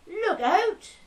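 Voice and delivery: high, loud Norfolk yell; shouted warning. Acted. Driver shouting at a wobbling cyclist, urgent yell. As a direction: holler it out sharply in character. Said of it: "I shouted at him".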